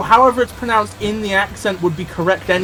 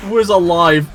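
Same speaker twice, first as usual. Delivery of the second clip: louder and higher, outraged; a louder indignant rant about which robots were kept; 4 semitones higher, 6 dB louder than usual. Real, not acted. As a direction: vent at how unfair it is. Ranting about which robots survive.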